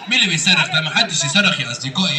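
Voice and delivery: very low-pitched puppet character; Aragoz puppet. Acted. Puppet explaining his visit to the host, Aragoz voice. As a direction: play a comic puppet, far below your own pitch. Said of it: "الأراجوز ده وهو بيرغي".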